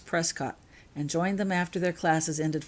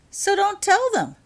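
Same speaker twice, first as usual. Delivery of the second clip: much higher, louder exasperated retort; blunt retort; 8 dB louder than usual. A narrator's reading. Laurel answers Dylan, exasperated and loud. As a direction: toss it off as obvious.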